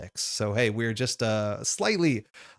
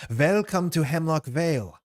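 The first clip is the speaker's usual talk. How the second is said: put-on higher-pitched imposing matriarch with a Germanic accent; Mother Rachel; 6 semitones higher, 3 dB louder than usual. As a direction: welcome newcomers grandly in a put-on German accent. Mother Rachel greets the newcomers.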